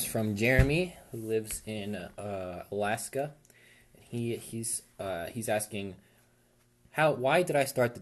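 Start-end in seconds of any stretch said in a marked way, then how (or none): none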